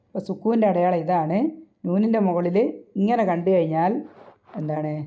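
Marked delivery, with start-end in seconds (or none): none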